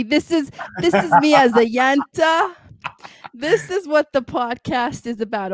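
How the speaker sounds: Yenta voice. Pretend. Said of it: "I'm gonna put on my Yenta voice now".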